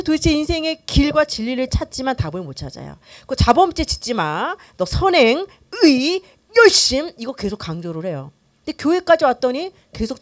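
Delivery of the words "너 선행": normal talking voice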